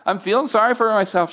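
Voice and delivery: imitated tearful complaint. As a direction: voice climbing high in mock-tearful self-pity. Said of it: "now I start crying".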